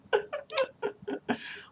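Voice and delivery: very high, wheezy laugh; helpless high laughter trailing on; real squeaky laughing fit. Real, not acted. Laughing at own bottle demo.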